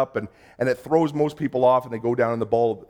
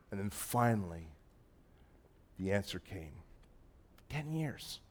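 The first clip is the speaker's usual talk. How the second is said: much softer, hushed and sincere; heartfelt testimony; 5 semitones lower, 11 dB quieter than usual. Real, not acted. Pastor telling the church about the years of longing for children, hushed and sincere. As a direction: let it sink to a near hush, remembering the long wait.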